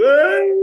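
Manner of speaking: high, loud mock wail of a mourner; a hammed-up fake cry at a wake. Mourners faking grief at wake.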